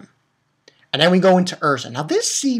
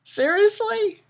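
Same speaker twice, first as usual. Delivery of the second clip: high squealing disbelief, pitched way up; mock shocked squeal; much slower than usual. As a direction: squeal it like you can't believe your eyes. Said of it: "and I was like".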